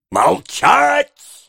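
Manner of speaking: high, sharp command for silence; stern shout. A narrator's reading. Beadle silencing Noah's complaints, stern shout. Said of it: "строго прикрикнул мистер Бамбл".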